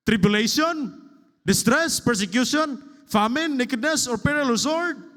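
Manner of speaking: fervent preaching list; preaching build. Acted. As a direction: hammer out each trouble, rising. Reading a scripture list aloud.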